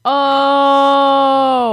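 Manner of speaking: playful shout. Real, not acted. Teasing playful outburst.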